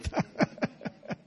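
soft, voiceless chuckle; short breathy laugh to oneself. Real, not acted. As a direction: laugh quietly under the breath. Laughs after joking about notes.

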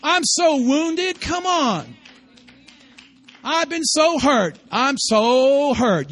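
slow, loud self-pitying whine; mock self-pity. Preacher mocking a proud, wounded churchgoer, slow mock self-pity. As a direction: drag it out like a wounded martyr. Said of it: "That's that arrogance".

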